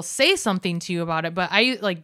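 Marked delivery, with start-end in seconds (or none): none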